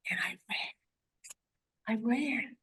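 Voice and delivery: barely voiced, slow whisper; hushed whisper. Real, not acted. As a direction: let it slip out in a breathless hush. Recalling panicked flight.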